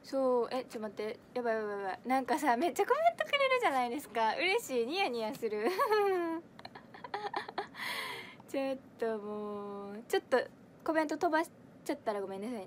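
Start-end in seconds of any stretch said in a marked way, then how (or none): 2.6-5.7 really thrilled, tipping into laughter; giddy delight. Real, not acted. 5.7-8.4 high, drawn-out delighted giggle; long happy laugh climbing at the end; giddy giggle. Real, not acted. Giddy at all the comments.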